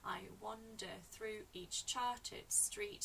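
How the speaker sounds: "I wander through each charted street" slowly, syllable by syllable; slow, separated syllables on "I wander through each charted street"; counting syllables. Shown as a demonstration. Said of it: "if you count the syllables".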